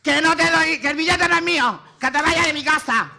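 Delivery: high, very loud screaming; screaming at the man to leave her house; acted furious yelling. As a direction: shriek him out of the house. Acted quarrel with conman.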